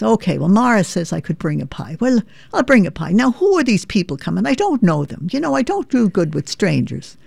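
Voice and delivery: puzzled Irish mum asking about a potluck; Irish mother. Retold or imitated. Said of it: "my mother would say".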